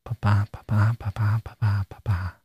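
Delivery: low "Ba-ba" pulse, weak then strong; heartbeat rhythm. Shown as a demonstration. Teacher sounding the heartbeat under the verse, "ba-ba" low and even. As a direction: tap out "ba-ba" five times like a low heartbeat, fading. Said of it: "with a heartbeat".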